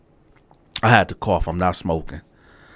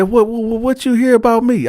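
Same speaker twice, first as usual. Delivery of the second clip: lightly voiced flustered old man, pitched higher; flustered cellmate; 12 semitones higher than usual. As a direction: play him caught out and apologizing, higher up.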